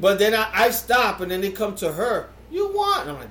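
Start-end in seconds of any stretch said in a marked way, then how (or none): 2.5-3.0 high-pitched imitated offer; mock server's question, pitched way up; acted offer. Server offering more meat to the daughter, imitated in a high voice.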